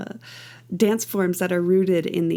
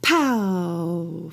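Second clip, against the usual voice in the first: "Pow" bursts at the front, then floats out; long, fading "Pow"; impulse accent; 2 semitones lower than usual. Shown as a demonstration. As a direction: punch the start of "Pow" and let the vowel drift off. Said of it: "a sense of floating out of the impulse".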